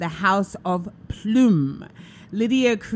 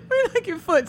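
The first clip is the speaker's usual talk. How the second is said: laughing echo; 12 semitones higher than usual. Retold or imitated. Echoing the child's joke.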